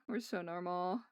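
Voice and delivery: ordinary talk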